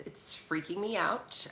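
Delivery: usual speaking voice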